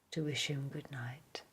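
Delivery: plain reading voice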